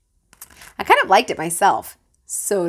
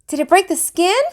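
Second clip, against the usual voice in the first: lightly voiced worried mom, higher; concerned mother; 11 semitones higher, 4 dB louder than usual. A narrator's reading. Mother checking grandma's bitten hand, lightly voiced. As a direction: ask with quick concern. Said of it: "my mother asked".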